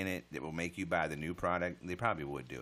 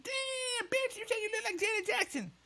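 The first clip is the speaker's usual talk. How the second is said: very high squeaky put-on voice, a bit louder; mock sassy voice; 4 dB louder than usual. Streamer retelling what he said, in a high mocking voice. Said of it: "I said".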